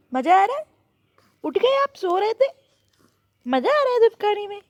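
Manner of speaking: mother cooing at her infant in the sun. Real, not acted.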